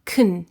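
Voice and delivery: can as kn, vowel gone; weak can. Shown as a demonstration. Teacher models weak can as kn. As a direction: swallow the vowel in kn. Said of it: "the weak form, which is".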